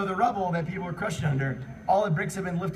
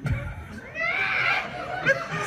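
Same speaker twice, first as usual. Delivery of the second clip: high shrieking laugh; 5 dB quieter than usual. Real, not acted. Narrator cracks up reading the fanfic, squealing laugh.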